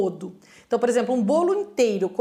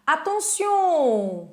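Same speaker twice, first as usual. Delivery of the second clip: Attention! stretched very slow and emphatic; drawn-out warning; 3 semitones higher, 3 dB louder, much slower than usual. Shown as a demonstration. Teacher warns students of an exception, drawn out. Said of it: "Anote aí essa exceção".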